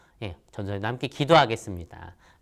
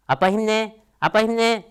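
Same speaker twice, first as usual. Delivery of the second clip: higher, lightly voiced little kid; cheering child; 10 semitones higher, 5 dB louder than usual. Daughter cheering on busy dad, light higher chirp.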